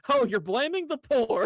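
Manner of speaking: high, drawn-out parody of an accuser; mock accusation. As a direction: mimic the accusation with exaggerated indignation. Repeats opponent's accusation in mockery.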